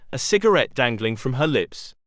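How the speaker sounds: ordinary delivery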